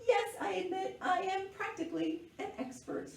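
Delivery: high-pitched little show-off; playful boasting. A narrator's reading. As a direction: admit it proudly, pitched well up. Girl boasts to Grandpa.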